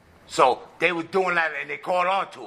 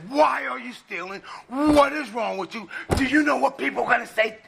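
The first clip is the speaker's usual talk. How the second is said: retold yelling, more pitch than volume; mock screaming husband; 7 semitones higher than usual. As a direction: scold her in a high, exasperated rant. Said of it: "he's screaming and yelling at her".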